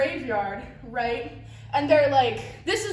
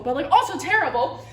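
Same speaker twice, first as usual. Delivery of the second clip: ordinary talk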